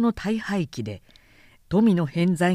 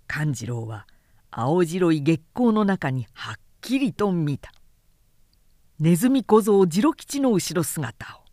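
ordinary delivery

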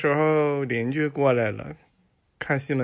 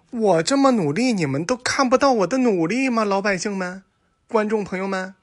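higher, louder whining plea; whiny complaint; 7 semitones higher, 4 dB louder than usual. Acted. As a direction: complain to the audience that nobody sees your effort. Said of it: "他就抱怨".

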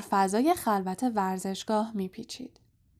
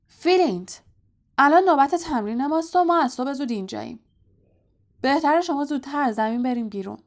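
loud, fast, higher bellow; roaring; 5 semitones higher, 5 dB louder, faster than usual. A narrator's reading. Captain roaring at the Slytherin captain to leave, loud and fast. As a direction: roar at the rival captain. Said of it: "وود نعره زنان".

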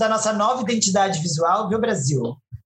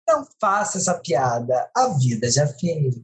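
ordinary delivery

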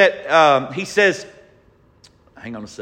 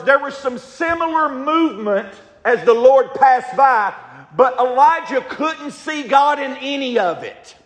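loud, high scripture reading in full preach; preacher reads scripture at full force; fiery preaching; 8 semitones higher, 7 dB louder than usual. Acted. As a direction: proclaim it like thunder.

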